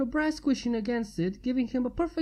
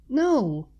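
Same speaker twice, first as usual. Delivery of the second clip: urgent; 4 semitones higher, 5 dB louder than usual. A narrator's reading. Twilight sits up, stops him.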